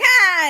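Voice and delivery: high, loud scream; leprechaun shriek. Acted. Leprechaun bursts through the floor screaming at them. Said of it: "he's like".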